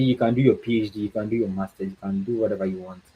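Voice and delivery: normal talking voice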